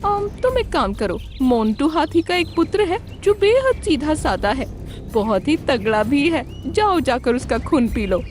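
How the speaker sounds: high, lively fox voice; scheming clever fox. Acted. Fox sends crane after prey, high scheming fox voice. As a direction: plot craftily in a high voice.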